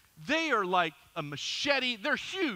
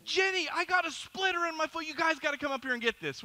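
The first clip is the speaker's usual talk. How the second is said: retold yell; 8 semitones higher, 3 dB louder than usual. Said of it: "and I'm yelling".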